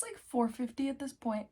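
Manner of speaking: normal talking voice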